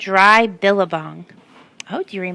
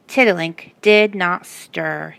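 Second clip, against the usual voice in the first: ordinary delivery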